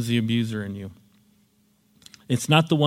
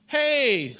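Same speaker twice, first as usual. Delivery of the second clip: defensive protest; 10 semitones higher than usual. Retold or imitated. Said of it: "says Paul".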